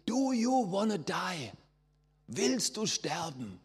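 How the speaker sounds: high, slow, drawn-out frontman scream; screamed song title. Retold or imitated. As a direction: scream it out to the crowd like a metal singer. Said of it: "hat reingeschrien". (langgezogener, hoher Schrei des Sängers)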